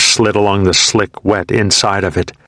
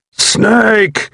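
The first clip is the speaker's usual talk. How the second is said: desperate cry; 14 semitones higher than usual. A narrator's reading. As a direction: cry out, more desperate now. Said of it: "he grunted painfully".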